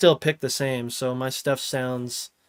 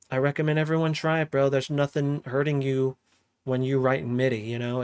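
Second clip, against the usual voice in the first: normal talking voice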